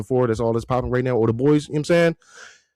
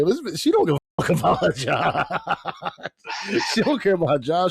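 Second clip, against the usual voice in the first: laughing rant; 7 semitones higher than usual. Retold or imitated. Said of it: "I'm like".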